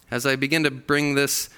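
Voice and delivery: ordinary talk, nothing marked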